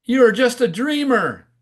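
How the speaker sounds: high, loud taunt; heckling frog's yell. Acted. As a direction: yell it out as a jeer. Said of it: "one of the frogs yells out".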